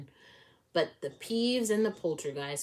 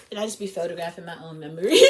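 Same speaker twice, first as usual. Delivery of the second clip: laughing; 8 dB louder, much faster than usual. Real, not acted. Laughing while confessing.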